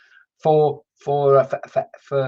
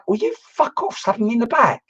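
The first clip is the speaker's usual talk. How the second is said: mock outrage; 8 semitones higher than usual. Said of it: "I'm going".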